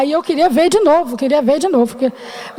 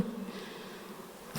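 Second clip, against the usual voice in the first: whisper; 30 dB quieter than usual. Real, not acted. Whispered aside after doctor.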